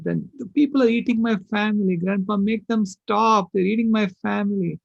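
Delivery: high, pleading child's cry, mildly acted; a grandpa mimicking his sobbing granddaughter; begging through tears, lightly voiced; retold bawling. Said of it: "then she started bawling".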